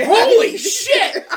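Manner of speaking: high, loud, drawn-out astonishment; hammed-up shout of disbelief; amazed outburst. Retold amazement after hanging up.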